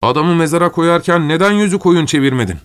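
high-pitched agitated demand; exasperated outburst, voice climbing high; shouted reproach. A narrator's reading. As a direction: cry out the question, pitch soaring. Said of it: "diye bağırdı".

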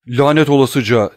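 furious cry of a cornered man; cry out a curse. Acted. Kedrus curses the abbé before stabbing, furious cry. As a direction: spit the curse out loud. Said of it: "diye haykırdı".

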